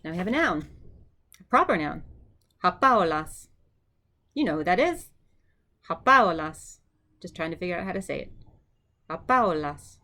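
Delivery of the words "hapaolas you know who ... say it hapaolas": Hapaolas, loud, with a falling tune; Greek name modelled. Shown as a demonstration. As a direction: say Hapaolas for the class to repeat. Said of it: "Your turn".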